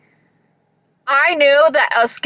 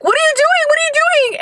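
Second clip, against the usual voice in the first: panicked shriek; much faster than usual. Retold or imitated. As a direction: shriek it in alarm at the driver. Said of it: "I'm like".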